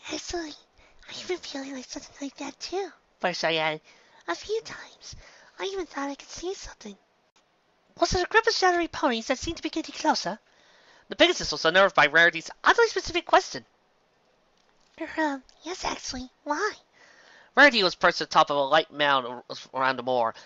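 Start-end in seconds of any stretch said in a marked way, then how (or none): 0.1-7.0 much higher, much softer shy pony; timid pony. A narrator's reading. Fluttershy timid. 8.0-10.4 higher, elegant questioning mare; a hint of a posh lady asking; refined pony. A narrator's reading. Rarity asks. 15.0-16.7 much higher, much softer shy pony; timid pony. A narrator's reading. Fluttershy timid.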